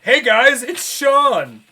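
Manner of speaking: high, loud character call; Caden announcing. Acted. Caden introduces his summon to the party, loud and high. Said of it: "And Caden goes".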